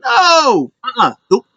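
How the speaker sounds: high squeaky nope, hammed up; mock dismissive no. Mocking a refusal in a high voice. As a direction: brush it off in a high put-on voice. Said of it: "And it was like".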